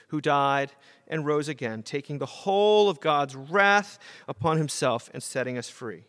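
ordinary talk, nothing marked

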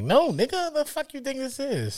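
incredulous high-pitched no; indignant high retort. Retold or imitated. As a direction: pitch jumps high in disbelief. Indignant retort to pushy guy.

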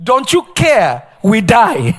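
slow, pointed accusing cry; mock-frightened complaint; panicked disciples. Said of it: "They said".

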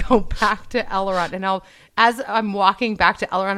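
ordinary delivery

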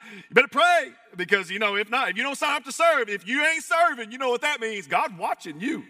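high, loud, hammed-up threat; mock stern warning. Legalist warning those who don't serve, mock stern warning. Mocking a joyless obedience mentality.

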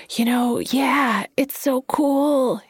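put-on slow, high, airy voice of a jittery street kid; high and breathy. A narrator's reading. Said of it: "his voice high and breathy".

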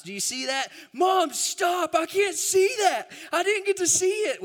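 put-on high, loud child voice crying out from the back seat; shrill little kid yelling to stop the car; kids' excited shout. Little boys shouting at Mom to stop the car, high and loud.

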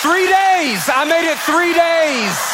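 high, drawn-out boast; mock triumph. Real, not acted. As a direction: crow proudly over a tiny win. Mock triumph at tiny success.

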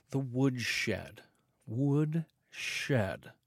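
soft, distant echo of the name; fading echo. Shown as a demonstration. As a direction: let it fade like an echo. Echoing show name.

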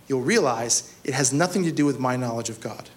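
ordinary talk, nothing marked

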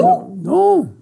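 high astonished no; shocked protest of innocence; both no's squeaked up high in disbelief; surprised no. Real, not acted. Surprised denial of not eating.

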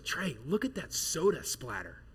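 soft, slow mock whisper in a cinema; quietly imitating the wife whispering in the front row; imitated whisper. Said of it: "because she whispers".

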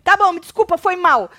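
loud, high-pitched tossed-off sorry; mock grudging apology. Retelling Fu's first apology, loud mock grudging. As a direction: throw the apology at them.

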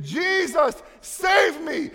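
high, loud desperate cry of Jesus save me; screaming for help. Retold or imitated. Drowning person screaming to Jesus, loud desperate cry. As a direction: scream it like someone drowning in the waves. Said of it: "the only solution out of this is to scream".

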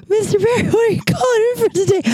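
high, strained voice fighting back laughter; laughing hysterically. Retold or imitated. Answering Terry Berry's call while laughing hysterically, pitched high. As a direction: try to sound professional while cracking up. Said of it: "audibly laughing hysterically".

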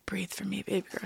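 breathy, seductive whisper. Retold or imitated. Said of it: "a TikTok lady who she's like".

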